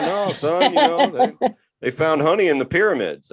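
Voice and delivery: high, knowing dad voice; dad insisting with a know-it-all air. Retold or imitated.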